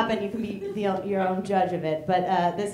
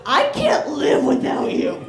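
overwrought shout; 5 semitones higher, 9 dB louder, slower than usual. Pretend. Mocking rom-com line.

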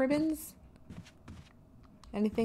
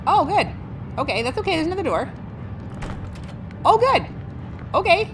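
much higher and louder, nervous relief; frantic relief; 10 semitones higher, 4 dB louder, faster than usual. Real, not acted. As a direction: squeak out relief after a scare. Relieved at escape.

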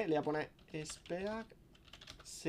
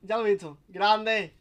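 ordinary talk

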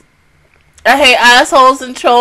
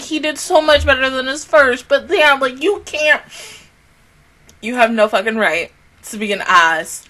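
ordinary talk

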